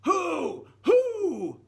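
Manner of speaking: loud, high mock yell. Shown as a demonstration. Teacher showing yelling is too much. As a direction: shout it across a field. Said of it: "Or should we be yelling it".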